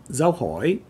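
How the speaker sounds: dao hỏi with hỏi dipping then rising; question mark tone. Shown as a demonstration. Teacher naming the question mark tone, questioning lilt. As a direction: swing hỏi upward like a question. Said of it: "The question mark tone".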